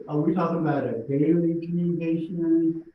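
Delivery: normal talking voice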